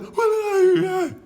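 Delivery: high shrill disbelieving cry; mock shocked man. Appalled man reacting to his grey face, high squeal. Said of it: "He's like, he's like".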